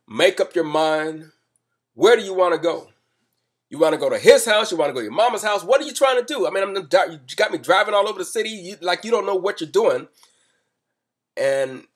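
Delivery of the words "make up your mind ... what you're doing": loud, high, angry tirade at his passenger; staged furious rant, loud and high; yelling at her. Retold or imitated. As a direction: bark at her like you've lost patience. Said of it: "started yelling at her".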